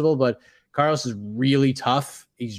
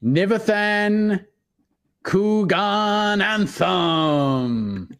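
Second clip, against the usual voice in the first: slow, drawn-out ring announcer call; boxing ring announcer; 5 semitones higher, much slower than usual. Shown as a demonstration. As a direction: stretch the name out like a fight intro. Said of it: "I have to say it like a ringing announcer".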